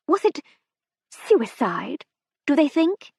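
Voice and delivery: a nosy hostess gushing over gossip; breathless society matron. A narrator's reading. Mrs Seddon gushes about death.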